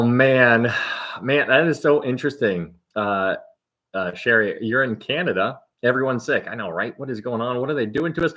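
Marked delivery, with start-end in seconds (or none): none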